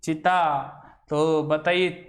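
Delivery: usual speaking voice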